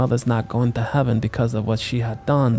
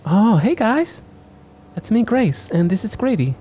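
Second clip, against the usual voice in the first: cheerful woman voice; 9 semitones higher than usual. Acted. Voicing Grace cheerfully.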